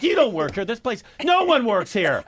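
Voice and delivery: re-enacted shouting match; screaming. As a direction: argue it loudly and high. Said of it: "I'm screaming".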